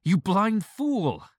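very high, loud, drawn out slowly; piercing, slow shrill outburst; shrill impatient cry. A narrator's reading. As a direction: scream the insult in exasperation. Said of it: "I cried impatiently".

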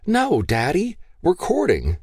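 put-on high, pleading young woman's voice; pleading daughter. Daughter begging her gun-waving father, high and pleading. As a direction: beg your father to spare him.

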